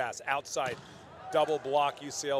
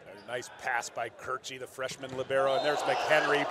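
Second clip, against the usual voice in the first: ordinary delivery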